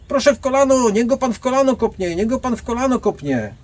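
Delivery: high, loud, insistent woman's voice; eager woman. Retold or imitated. Bystander woman urges the guard to kick the thief's knee, high and loud. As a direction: egg him on like a know-it-all bystander.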